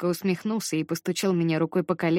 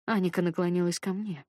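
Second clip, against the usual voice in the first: ordinary delivery, nothing marked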